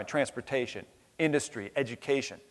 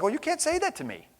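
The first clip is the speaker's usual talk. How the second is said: indignant retort; 13 semitones higher than usual. Retold or imitated. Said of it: "I was like".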